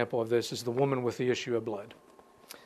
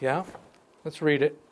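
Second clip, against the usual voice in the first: usual speaking voice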